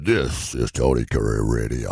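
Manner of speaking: low, slow, big announcer voice; deep booming station ID; ballsy radio voice. Shown as a demonstration. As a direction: give it the biggest balls. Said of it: "I want balls".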